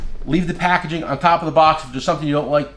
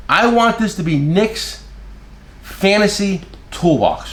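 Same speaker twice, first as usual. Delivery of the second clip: slow quoted request; 4 semitones higher, slower than usual. Retold or imitated. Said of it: "he goes".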